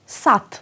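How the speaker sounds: σατ ending in "t", not "d"; final d as t. Shown as a demonstration. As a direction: close σατ on a clean voiceless t. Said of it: "στο τέλος ένα τ". (σατ με «τ» στο τέλος, όχι «ντ»)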